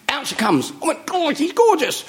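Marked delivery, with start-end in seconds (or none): 1.1-2.0 very high, smitten exclamation; mock delight. Man seeing the next actress, acted high delight. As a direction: gush it in a high acted squeal.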